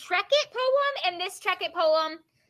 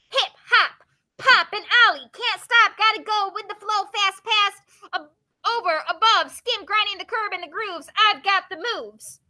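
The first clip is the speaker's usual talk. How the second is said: punchy, louder beat on short phrases; poem reading; 3 semitones higher, 7 dB louder than usual. Acted. Teacher performs the skateboard poem for the class, punchy poem reading. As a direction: read it out like a bouncy chant.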